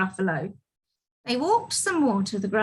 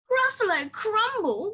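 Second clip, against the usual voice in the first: high, aghast echo; horrified monster; 12 semitones higher than usual. Acted. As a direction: repeat it in storybook alarm. Gruffalo horrified at being food.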